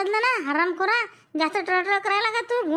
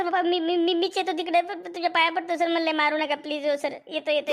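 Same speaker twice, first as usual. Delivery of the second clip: ordinary talk, nothing marked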